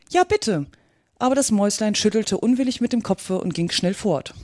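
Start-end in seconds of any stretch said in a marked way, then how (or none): 0.1-0.7 high, eager plea; chorus of creatures. A narrator's reading. Other animals beg the mouse to stay, high and pleading. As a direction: beg in a raised little voice. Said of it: "die anderen wiederholten im Chor".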